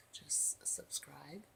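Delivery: slow sleepy whisper. Real, not acted. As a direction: trail it off into sleep. Sleepy sign-off.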